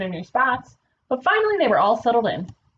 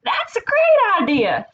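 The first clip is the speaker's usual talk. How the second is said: high, loud, stretched-out delight; mock shriek; 10 semitones higher, 5 dB louder, slower than usual. Nebraska hearing Kansas's party idea, excited shriek. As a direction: squeal it slowly, loud and high. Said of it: "shrieked Nebraska".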